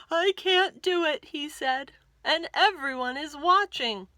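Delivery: scared stiff up high; panicky. Acted. George panicking on the high board.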